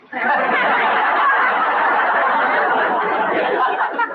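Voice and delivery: breathy triumphant giggle; a sly little laugh at her own joke. Acted. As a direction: sly giggle after the punchline. Katie laughs at her trick.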